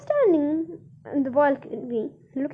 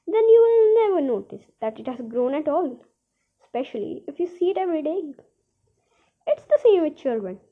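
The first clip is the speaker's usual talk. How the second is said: plain reading voice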